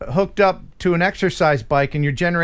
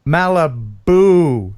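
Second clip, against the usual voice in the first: "Malibu" stretched very slowly; drawn-out punchline; 2 semitones lower, 4 dB louder, much slower than usual. Real, not acted. Host repeats the caller's ghost joke, "Malibu" drawn out. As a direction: savour the pun, dragging out "Malibu".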